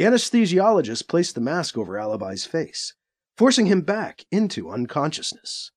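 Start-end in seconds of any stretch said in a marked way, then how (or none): none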